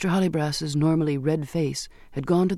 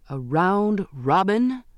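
slow, stunned question; captain stunned by the news of mutiny; shocked exclamation; 3 semitones higher, much slower than usual. Acted. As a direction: let the shock stretch the words out. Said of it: "he exclaimed".

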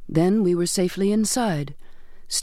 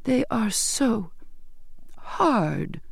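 slow, high start sinking to a hush; hushed, halting horror; horrified; 4 semitones higher, much slower than usual. A narrator's reading. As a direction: falter in appalled disbelief before the last word. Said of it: "she asked in horror".

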